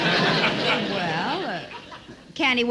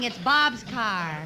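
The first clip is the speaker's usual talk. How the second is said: normal talking voice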